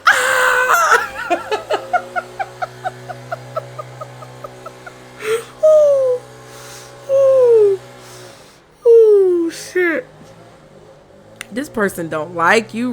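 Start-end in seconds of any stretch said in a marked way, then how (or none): 0.1-1.3 high laughing shriek; mock scream. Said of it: "And she'd be like". 1.4-4.9 high airy wheezing laugh; breathless laugh. Real, not acted. Laughing after the mock scream. 5.6-9.5 loud, high oohs catching breath; high laughing oohs. Real, not acted. Winding down from laughter.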